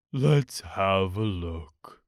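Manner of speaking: low, slow, mournful donkey voice; gloomy Eeyore. A narrator's reading. Narrator voicing Eeyore to Pooh, low and glum. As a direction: drag it out like a sad old donkey.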